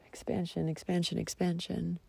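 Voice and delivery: quiet repeated chant; soft mantra. Real, not acted. Closing her insight, soft repeated mantra. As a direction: murmur it like a mantra.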